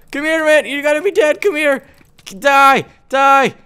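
high-pitched frantic game taunting; frantic attack cries. Real, not acted. Chasing an enemy to kill it.

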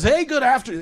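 high, loud radio-DJ patter; a hammed-up failed DJ on the mic; airline captain's DJ voice. Captain greeting passengers on the intercom, hammed-up DJ voice. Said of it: "in that fucking voice, that voice".

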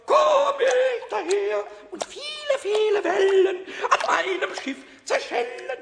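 slow grand captain's boast; proud captain. Acted. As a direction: declaim like a heroic captain. Pumuckl plays captain.

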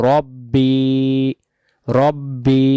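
রব্বি with the final বি drawn out one alif length; stretched ending. Shown as a demonstration. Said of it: "বি বলে এক আলিফ টান দিতে হবে". (রব্বি, শেষের বি এক আলিফ টেনে)

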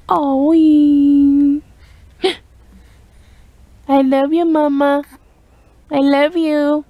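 high, stretched-out motherese. Real, not acted. Mother cooing at newborn daughter.

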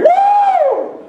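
high, loud football-fan woo; a churchgoer's cheer, mimicked as a high loud whoop; imitated crowd whoop. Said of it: "Because I was hearing".

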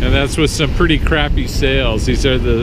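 loud, high voice over wind noise; shouting over wind. Real, not acted. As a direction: shout so you carry over the gusts. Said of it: "if you can hear me over the wind noise".

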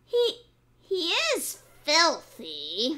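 a hint of disgust; musing fox. A narrator's reading. Fox talking to himself about the piglet. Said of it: "the fox said to himself".

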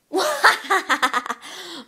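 high, forced villain cackle; failed evil laugh. Acted. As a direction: attempt an evil laugh and miss. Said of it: "I can't evil laugh".

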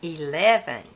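ordinary delivery, nothing marked

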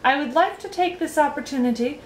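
high, tentative speech opening; timid. A narrator's reading. Young guest starting a banquet speech, timidly. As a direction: begin a formal address with mild timidity. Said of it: "started Milo timidly".

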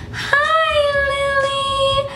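very high squeaky sing-song hello to the dog; high-pitched greeting. Retold or imitated. Greeting her dog after school, a very high squeal. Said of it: "I make this high-pitched noise".

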